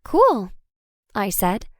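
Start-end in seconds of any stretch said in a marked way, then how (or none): 0.0-0.5 half-hearted. A narrator's reading. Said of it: "half meaning it".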